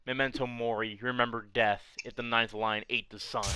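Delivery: normal talking voice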